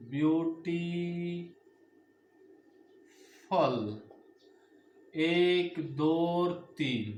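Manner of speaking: spelled out and counted slowly, letter by letter; slow syllable demo. Shown as a demonstration. Teacher slowly counting syllables of a word for students. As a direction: break the word into its syllables for the class. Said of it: "हम इसको इस तरीके से कह सकते हैं".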